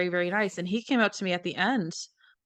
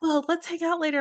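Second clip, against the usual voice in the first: excited fans; 10 semitones higher than usual. Retold or imitated. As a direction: rattle off eager questions like a thrilled fan. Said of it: "They're like".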